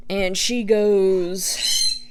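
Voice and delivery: ordinary delivery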